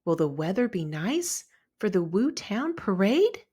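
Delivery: ordinary delivery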